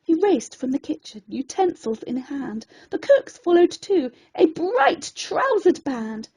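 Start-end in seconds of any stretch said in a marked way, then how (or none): none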